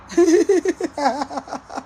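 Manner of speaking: long scornful laugh; mocking laugh. Real, not acted. Mocking laugh after insult.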